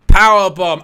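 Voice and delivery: loud sudden call; excited shout. Real, not acted. Calling the powerbomb as it hits, a loud shout. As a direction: bellow the move name.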